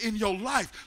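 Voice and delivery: ordinary delivery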